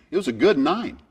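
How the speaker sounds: joking pride in a bad hole; wry boast. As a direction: sell the nine proudly. Wry punchline.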